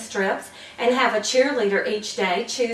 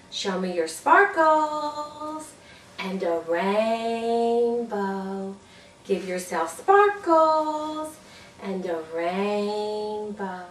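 sparkles and rainbow; much slower than usual. Shown as a demonstration. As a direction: float it down like a rainbow arc.

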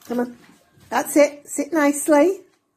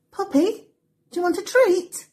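pet voice; 9 semitones higher than usual. Real, not acted. Offering Poppy a treat, high coaxing voice. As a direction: tempt the dog with a high sweet voice.

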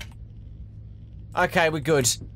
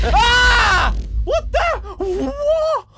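scream, then high startled questions; loud high panic; terrified scream; 5 dB louder, much slower than usual. Real, not acted. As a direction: shriek and gasp out what. Jump scare with flashlight.

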